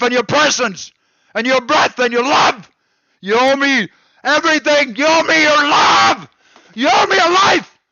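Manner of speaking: furious rant. Real, not acted. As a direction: spit out every grievance, climbing in pitch. Escalating furious rant at viewers.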